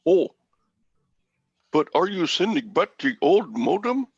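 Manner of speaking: high-pitched imitation of an Indian tech-support agent's accent; Indian-accent impression of a phone agent; imitated Indian accent. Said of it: "you could do that accent".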